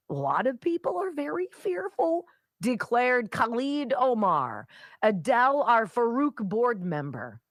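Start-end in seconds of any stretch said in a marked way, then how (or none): none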